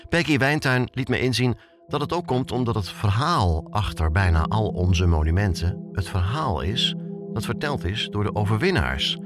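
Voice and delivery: normal talking voice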